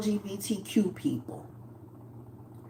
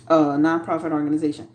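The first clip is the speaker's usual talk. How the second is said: usual speaking voice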